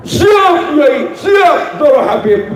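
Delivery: high, shouted eager yes-sir; eager obedience. Retold or imitated. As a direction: snap to it like an overeager servant. Eager servile reply to the kiai.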